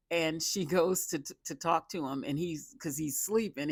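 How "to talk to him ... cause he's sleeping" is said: usual speaking voice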